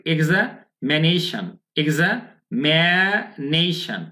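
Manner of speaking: এক্সামিনেশন very slowly as "egza, me, neishan"; syllable-split pronunciation. Shown as a demonstration. Teacher giving the next word to learners, syllable-split pronunciation. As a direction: break এক্সামিনেশন into drawn-out chunks. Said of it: "এরপর কি?".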